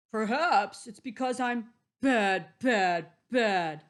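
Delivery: lamenting wolf. Acted.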